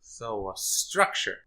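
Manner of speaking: slow, soft, flat-out deadpan; dry, slow repeat of the joke. Real, not acted. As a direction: drag it out quietly and dry.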